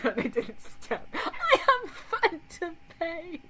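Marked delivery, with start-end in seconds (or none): 1.1-3.4 high, slow posh British drawl of the phrase; hammed-up British accent, high and drawn out; mock British accent. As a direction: stretch it out like an overdone Brit. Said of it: "It's so British".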